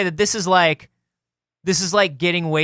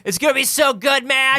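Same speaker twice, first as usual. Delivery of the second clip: put-on much higher drunk shout; drunk excited shout; 8 semitones higher than usual. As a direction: yell it, giddy and wasted. Acted drunk road trip.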